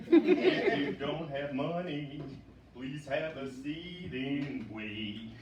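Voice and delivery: [name] impression. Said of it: "I love the [name] dialect".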